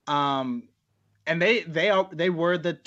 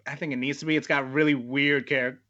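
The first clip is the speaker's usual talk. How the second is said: ordinary delivery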